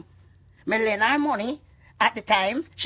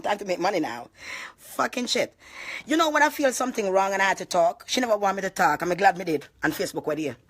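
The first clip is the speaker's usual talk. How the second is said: ordinary talk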